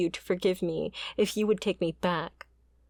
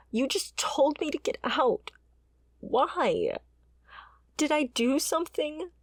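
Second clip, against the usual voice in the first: higher, anguished questions; hurt pleading; 8 semitones higher than usual. Acted. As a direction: beg him for an answer, near tears. Pleading with the one who left her.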